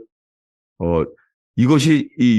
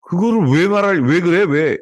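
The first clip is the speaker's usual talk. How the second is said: higher, sharp irritated comeback; irritated spouse; 6 semitones higher than usual. Retold or imitated. Annoyed spouse snapping back at an offered forgiveness, higher and irritated. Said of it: "이렇게 말하면 뭐라 그래요?".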